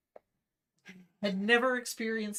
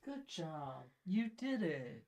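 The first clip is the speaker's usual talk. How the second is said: deadpan praise; 5 semitones lower, 8 dB quieter, slower than usual. Pretend.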